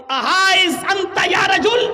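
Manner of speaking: slow, high Arabic shout; loud call. Retold or imitated. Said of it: "آپ نے پھر زور سے آواز دی".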